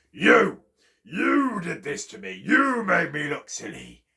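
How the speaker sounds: loud accusation, starting high; cross bear. A narrator's reading. Bear looking down at Chipmunk to blame him, crossly. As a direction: burst out crossly on the first word. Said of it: "Bear got so cross".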